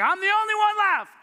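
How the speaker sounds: high, loud whining Elijah; self-pitying whine. Retold or imitated. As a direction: whine it like a sulking prophet. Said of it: "see the hint of self-pity".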